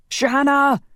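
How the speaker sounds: high, loud scream of her name; desperate scream. A narrator's reading. Roman shaking his fainted wife, a desperate scream. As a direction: scream it as she slips away. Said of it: "Roman screamed and shook her".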